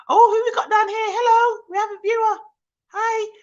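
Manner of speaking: high, loud squeal of welcome; excited greeting. Real, not acted. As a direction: hail a surprise guest in a piercing high voice. Spots a new live viewer.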